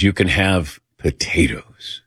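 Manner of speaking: slow whispered doctor impression; doctor's hushed voice. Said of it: "And he talked like this".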